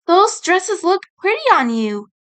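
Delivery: lightly voiced cheerful fairy. Rin character voice.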